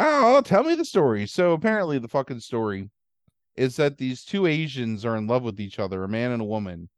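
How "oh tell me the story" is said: high, loud mock eagerness; mock eager. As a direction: gush like a fake excited customer. Eager listener voiced in retelling.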